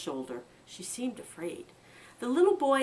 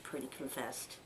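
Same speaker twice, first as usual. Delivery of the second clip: ordinary delivery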